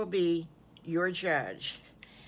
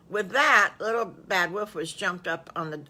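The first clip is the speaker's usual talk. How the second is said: ordinary delivery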